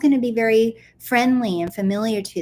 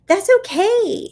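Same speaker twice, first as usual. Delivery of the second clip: higher, slow, loud reassurance; soothing high that's okay; 12 semitones higher, 6 dB louder, slower than usual. Retold or imitated. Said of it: "And I'm just like".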